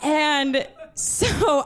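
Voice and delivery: usual speaking voice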